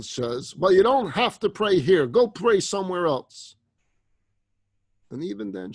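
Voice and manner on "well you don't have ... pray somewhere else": loud, higher-pitched telling-off; indignant scolding. Acted. Employee sending the praying woman away, scolding. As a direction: dismiss her loudly and crossly.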